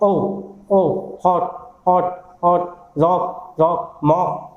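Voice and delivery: ओ held, then हॉट and रॉक repeated with short o; vowel drill. Shown as a demonstration. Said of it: "तो आगे उसका symbol लिखा है".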